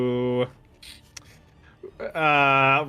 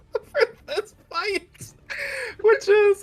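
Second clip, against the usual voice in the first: whiny fake sob; mock crying; 4 dB louder, slower than usual. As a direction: wail it in mock despair. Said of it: "my sad".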